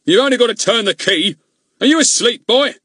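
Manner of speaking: high, loud furious rant; angry rant. Acted. Goon ranting at Ern from the boiler house. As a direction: rage at the boy through the door.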